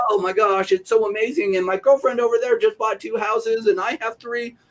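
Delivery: hammed-up giddy barber bragging about houses; gushing excitement. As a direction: squeal it like a thrilled gossip.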